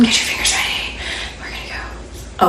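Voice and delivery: hushed whispered cue. Real, not acted. Librarian whispering to children before a song. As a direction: breathe it out softly to build anticipation.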